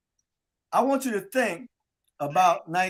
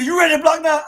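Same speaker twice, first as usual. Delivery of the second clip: coach challenge; 7 semitones higher, 6 dB louder, faster than usual. Retold or imitated. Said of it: "He said".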